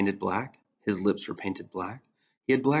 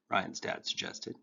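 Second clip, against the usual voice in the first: usual speaking voice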